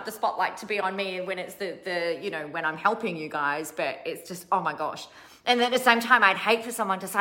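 ordinary talk, nothing marked

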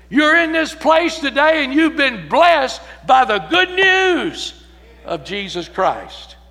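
loud, high, ringing sermon climax; full-force preaching. Acted. Preacher proclaiming good news to the congregation, full-force preaching. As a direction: preach it out to the whole church.

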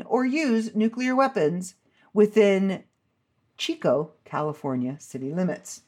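ordinary talk

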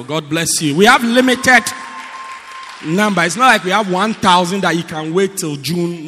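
loud, pushing the book offer at full force like a sermon; preaching-force sales pitch. Real, not acted. Pastor pushing the prophet's books on the church, loud preaching push. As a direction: sell it hard to the congregation.

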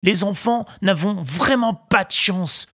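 put-on high, boastful puppet boy; a cocky little boy grumbling to himself; Pinocchio voice.

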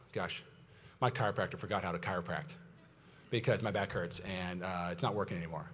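low, hushed mock complaint; downcast grumble. Patient privately blames the chiropractor, low and dejected. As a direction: grumble quietly to yourself.

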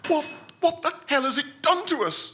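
high, stammering cry of alarm; a terrified man after the jolt, crying out; panicked Winthrop. Acted. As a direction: yelp it out, shaken by the jolt. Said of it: "said Winthrop".

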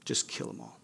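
whispered echo. Retold or imitated. Pharaoh's command repeated to the congregation as a chilling whisper. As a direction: let the order fade into a whisper.

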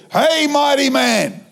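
high, effusive hailing from afar; shower him with hammed-up praise, pitched high; calling out praise. Preacher hailing the man from the doors, effusive encouragement. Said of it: "I start from the doors over there".

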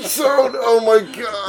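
after laughing hard. Real, not acted. Breathless after laughing fit.

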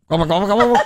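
gobble like a turkey; gobbling turkey voice. Pretend. Turkeys answer the pretend police.

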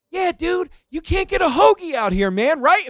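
high, loud, eager retelling of his own chat; excited. Retold or imitated. Calmed passenger chats excitedly with the cab driver about hoagies. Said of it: "I'm like".

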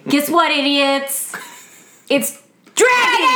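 booming show-opener yell, slow; echo mic shout. Acted. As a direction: announce it like a hyped host. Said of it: "echo mic".

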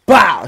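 loud mouth gunshot; loud imitated gunshot bang. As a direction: blurt a gunshot noise. Gunshot sound in story.

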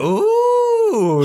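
high squealed ooh of delight. Real, not acted. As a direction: let out an impressed ooh. Reacting to cohost's wordplay.

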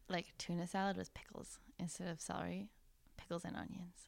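hushed unvoiced aside; whispering. Real, not acted. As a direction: whisper it like a secret. Sharing a gross snack.

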